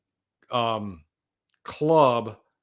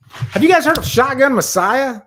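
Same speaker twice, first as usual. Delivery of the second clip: higher and louder, bursting with excitement; excited outburst; 11 semitones higher, 10 dB louder than usual. Real, not acted. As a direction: blurt it out to the chat, thrilled. Excited about a favorite band.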